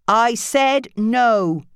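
slow, loud, firm no; mother refusing her daughter; stern refusal. Acted. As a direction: put your foot down. Nancy voiced.

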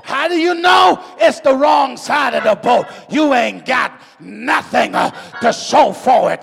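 loud whooping sermon build; preacher building to the climax over the organ; preaching at full force. Acted. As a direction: drive it home to the church.

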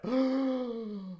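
high mock gasp of delight; fan's gasp. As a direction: gasp like an overexcited fan. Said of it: "for fans to go like".

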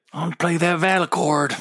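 high, lightly voiced Beckett quote; a hint of Tobias Beckett asking to play. Fan quotes the character wanting the instrument, lightly voiced. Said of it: "Tobias Beckett".

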